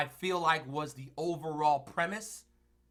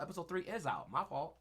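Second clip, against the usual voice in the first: usual speaking voice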